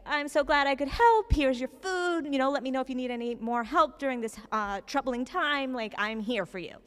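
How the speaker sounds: high, bright-toned cheery message voice; upbeat voicemail. Retold or imitated. Leaving a chipper voicemail for the elderly neighbor, high and bouncy. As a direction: chirp it like an eager helper. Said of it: "this really, like, you know, upbeat message".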